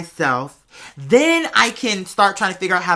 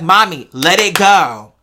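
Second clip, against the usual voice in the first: loud, slow, exasperated plea; aggravated; 8 dB louder, slower than usual. Retold or imitated. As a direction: push her off, fed up but loving. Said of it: "like, aggravated".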